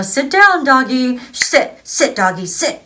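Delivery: loud, high commands to a dog; dog training. Retold or imitated. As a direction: order the puppy to sit, firm and loud.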